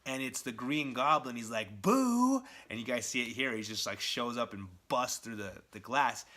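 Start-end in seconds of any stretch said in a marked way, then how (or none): 1.8-2.4 loud, high-pitched "Boo!"; mock scare. Green Goblin bursting in on Peter, a loud mock scare. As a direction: jump out with a big playful boo.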